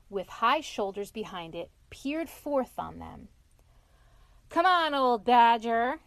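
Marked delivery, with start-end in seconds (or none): 4.5-6.0 loud, drawn-out call to a friend; friendly shout. A narrator's reading. Rat beckoning shy Badger over, shouting. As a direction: call out slowly and loudly. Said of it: "shouted the rat".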